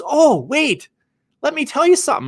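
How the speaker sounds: high, eager interruption; excited uncle. Retold or imitated. Uncle cutting into the real estate talk, high and eager. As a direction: burst in with a sudden idea. Uncle suddenly changing the subject.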